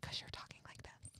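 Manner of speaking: imitating parents' barely audible whisper; mock whisper. Child showing parents how they talk, mock whisper. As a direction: drop to a toneless hush, mimicking them. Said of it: "you are basically whispering now".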